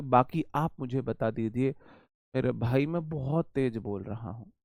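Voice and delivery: ordinary talk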